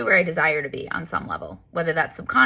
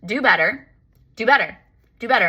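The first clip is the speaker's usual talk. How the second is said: higher, louder sassy repeats; sassy demand; 5 semitones higher, 4 dB louder than usual. Retold or imitated. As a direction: fire off each demand at the universe with attitude. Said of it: "This is how sassy I was".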